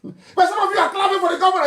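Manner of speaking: fast, high laugh-through line; laughing scornfully. Real, not acted. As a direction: laugh at them while you say it. Mocking those who clapped.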